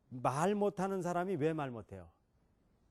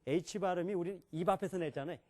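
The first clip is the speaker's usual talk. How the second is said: normal talking voice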